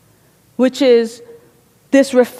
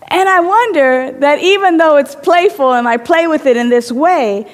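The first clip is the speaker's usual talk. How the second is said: normal talking voice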